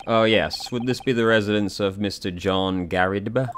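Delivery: ordinary delivery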